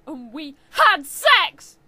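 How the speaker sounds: very loud, slow hammed-up exclaim; mock triumphant exclaim. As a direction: belt it out like a big reveal. Reading climactic line.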